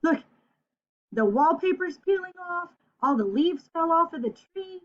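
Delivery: lively picture-book read-aloud; reading to children. Acted. Teacher reading a picture book aloud to her class. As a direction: read the story brightly to young kids.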